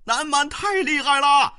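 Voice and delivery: very high, loud panicked cry; terrified shouting. A narrator's reading. Routed enemy soldiers crying out in panic. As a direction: scream in fear as you flee. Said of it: "喊叫道".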